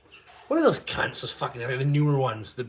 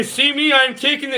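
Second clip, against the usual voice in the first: high, loud mock Trudeau voice; 12 semitones higher, 8 dB louder than usual. Mocking Trudeau announcing his precautions, high and loud. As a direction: ham up the prime minister's pious tone. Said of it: "he say".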